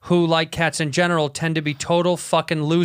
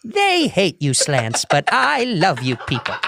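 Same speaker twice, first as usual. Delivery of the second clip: creepy admirer; 3 semitones higher, slower than usual. Retold or imitated. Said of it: "She's like".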